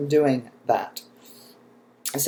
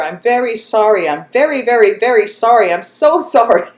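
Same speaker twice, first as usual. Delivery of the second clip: louder, higher, piling on each "very"; retold yelled apology; 5 semitones higher, 6 dB louder than usual. Dog owner yelling after the woman walking away, still ticked, a retold loud apology. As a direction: call out an insincere sorry, breaking into a laugh. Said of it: "I'm yelling after her".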